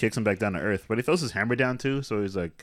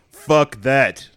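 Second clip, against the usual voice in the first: loud, higher, punched-out refusal; emphatic outburst; 5 semitones higher, 11 dB louder than usual. Acted. Odin cutting into the fight, emphatic outburst. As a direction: put both words down hard and loud.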